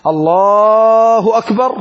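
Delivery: intoning Allahu akbar like opening prayer; takbir call. Shown as a demonstration. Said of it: "تكبيرة الإحرام لا بد أن تكون قائما".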